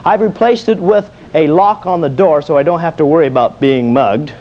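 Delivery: ordinary delivery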